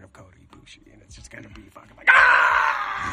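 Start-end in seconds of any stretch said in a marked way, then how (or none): 2.1-3.1 sudden high, loud yell; triumphant shout. Pretend. Imagining the hero's return, a loud high yell. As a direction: blast out a hammed-up cry.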